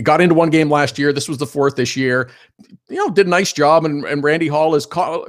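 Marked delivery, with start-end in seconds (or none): none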